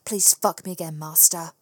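ordinary delivery, nothing marked

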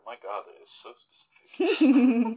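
high, loud, slow astonished exclamation; amazed. Real, not acted. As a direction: gasp out the words in disbelief. Surprised at the oddly specific question.

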